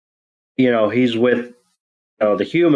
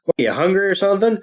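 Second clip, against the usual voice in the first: Ash teasing; 7 semitones higher, faster than usual. Retold or imitated. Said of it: "Ash goes".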